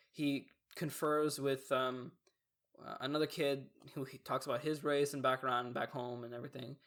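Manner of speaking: ordinary delivery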